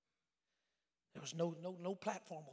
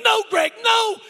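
high, full-force acted cry; urgent shouting; 27 dB louder, slower than usual. Neighbour trying to stop Greg's panic, urgent shouting.

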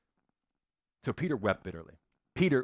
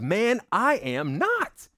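high, loud, drawn-out protest; indignant denial; 10 semitones higher, 5 dB louder, much slower than usual. Acted. Peter protests to his accuser that he is no follower, indignant. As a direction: snap back with an emphatic, rising denial.

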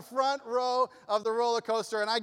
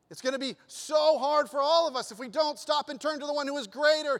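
preaching build; 7 semitones higher, 4 dB louder than usual. Real, not acted. Sermon closing appeal.

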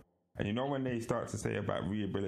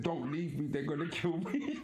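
mock pleading; 6 semitones higher than usual. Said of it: "I said".

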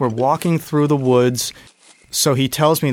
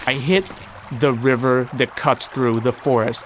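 ordinary delivery

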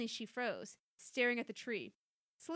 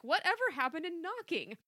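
higher-pitched protest; mildly acted teenage-style complaint; indignant cry; 6 semitones higher, 4 dB louder than usual. A narrator's reading. Daughter objects to mom bursting in, higher indignant cry. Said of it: "Chrissy cried".

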